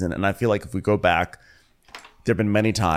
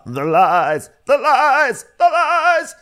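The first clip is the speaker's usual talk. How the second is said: high, loud repetition, climbing each time; Candy; 8 dB louder, much slower than usual. Retold or imitated. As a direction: imitate a housewife pushed to her limit, escalating. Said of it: "she starts doing repetition".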